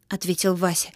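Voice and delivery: usual speaking voice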